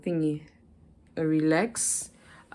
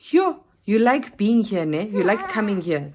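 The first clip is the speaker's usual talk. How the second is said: imitating rude clerk; 4 semitones higher, 6 dB louder than usual. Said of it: "She has the nerve to say to me".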